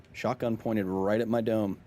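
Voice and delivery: ordinary delivery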